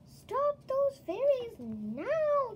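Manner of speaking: loud, high, slow furious yell. Acted. Jack Frost to his goblins as the fairies flee: furious yell. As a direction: order the goblins after them in a rage. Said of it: "Jack Frost yelled".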